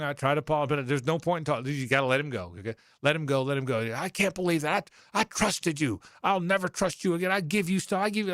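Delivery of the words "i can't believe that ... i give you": high-pitched mock rant from the coach; imitating angry coach. Coach on the phone scolds the sportswriter, high mock anger. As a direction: play Spurrier chewing him out, pitched up. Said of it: "he is giving me the business".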